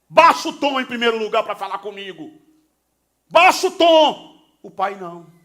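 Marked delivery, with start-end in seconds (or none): 0.1-2.3 stern rebuke. Pretend. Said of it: "Se fosse eu, responderia". 3.3-4.4 louder, higher and slow, each word hammered; sharper rebuke. Pretend. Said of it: "Se fosse eu, responderia".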